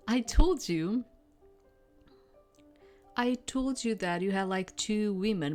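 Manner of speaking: plain reading voice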